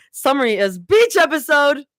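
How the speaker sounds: excited chapter reveal; excited announcement. Real, not acted. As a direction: announce it with a gleeful lift.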